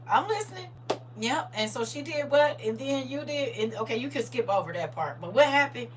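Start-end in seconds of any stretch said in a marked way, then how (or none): none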